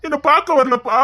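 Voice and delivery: high, plaintive mock wail; hammed-up weepy lament; imitated crying. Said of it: "என்னமோ அழுதா".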